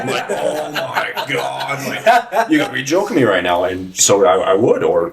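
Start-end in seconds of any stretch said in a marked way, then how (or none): 0.3-3.6 higher, laughing "oh my God", slow then rushed; mock disbelief. Host retelling his reaction to the trainer, mock disbelief. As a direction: play it as mock outrage, half laughing.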